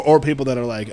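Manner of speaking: ordinary talk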